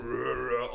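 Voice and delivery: a short throaty mock zombie growl. Mocking the show's silent zombies with a growl. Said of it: "you would hear it going".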